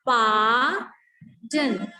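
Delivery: "Pa, din" split in two, long open "Pa" with no R; silent R. Shown as a demonstration. Teacher showing students the transcription, "Pa, din" with silent R. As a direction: draw out "Pa", drop the R, then a short "din". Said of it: "this R will be silent".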